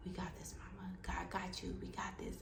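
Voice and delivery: quietly steady her nerves; reassuring. Retold or imitated. Anesthesiologist holding patient's hand, reassuring.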